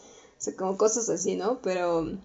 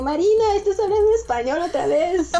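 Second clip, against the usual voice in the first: mom scolding; 8 semitones higher than usual. Retold or imitated. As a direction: call her out like a surprised mother. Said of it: "mi mamá así como".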